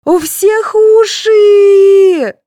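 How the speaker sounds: very high, loud, long-drawn shriek; squealing little girl. A narrator's reading. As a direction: screech it, stretching the last word out. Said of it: "завизжала Светлана".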